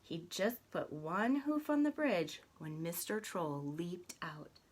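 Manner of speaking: plain reading voice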